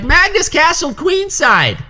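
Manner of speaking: high, slow Southern drawl; Southern viewer. Retold or imitated. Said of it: "it was not a bad Southern accent".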